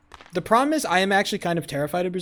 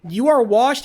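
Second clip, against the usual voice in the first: loud trash talk; 5 semitones higher, 6 dB louder, slower than usual. Real, not acted. Trash-talking chat member loudly.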